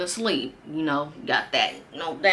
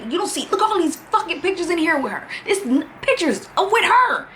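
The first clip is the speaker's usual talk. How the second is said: high, fast accusation; 11 semitones higher, faster than usual. Retold or imitated. Flipping the album pages at the boyfriend, a high furious rant. Showing him the photos.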